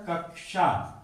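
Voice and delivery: कक्षा as "kak-sha", k then sh; pronounced as written. Shown as a demonstration. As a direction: let both k and sh sound in कक्षा. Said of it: "Hindi में लिखा होता है".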